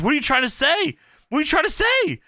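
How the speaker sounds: high-pitched mock agitation, climbing on the repeat; Booker flipping out. Imitating a wrestler flipping out at a colleague, high mock agitation. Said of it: "Booker's flipping out".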